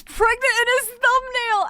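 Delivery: high, loud comic incredulity; hammed-up disbelief; mock shock. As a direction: blurt it out as a scandalised announcement. Mock shock at game art.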